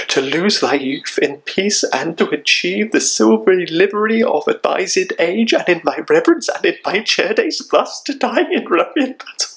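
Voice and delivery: high, rising tragic lament; acted anguish. Son over his slain father in battle, high anguished lament. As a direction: let the grief climb in pitch as you question fate.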